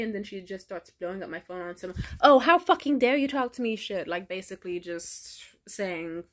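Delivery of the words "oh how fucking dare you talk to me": imitating the friend's loud, venomous snap; furious outburst. Sala blowing up her phone, mock furious. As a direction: hurl it at her in mock fury.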